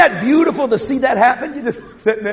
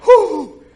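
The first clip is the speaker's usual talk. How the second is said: proud parent's cheering whoo; excited whoop; 5 semitones higher than usual. Acted. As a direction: let out a thrilled whoo. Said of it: "you go".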